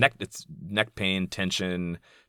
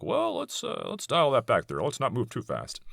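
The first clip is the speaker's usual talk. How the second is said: high, prissy, hedging mock voice; mock backpedaling liberal; 7 semitones higher, 3 dB quieter than usual. Mocking liberals who balk at real change, a high hedging voice. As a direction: pull back nervously, lightly mocked. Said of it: "you'll be suddenly being like".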